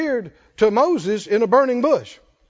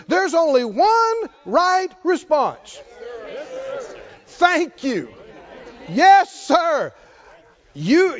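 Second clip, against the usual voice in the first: full-force preaching; 5 semitones higher, slower than usual. Acted. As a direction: build to a ringing proclamation.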